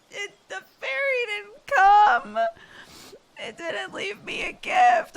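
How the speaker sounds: put-on high, wailing kid's sob; imitating a crying child. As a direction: mimic a little girl crying over a missing gift. Kid woke up crying, voiced.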